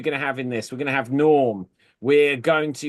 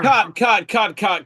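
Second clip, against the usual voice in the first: loud director call; 4 semitones higher, 6 dB louder than usual. Acted. Director stopping the take.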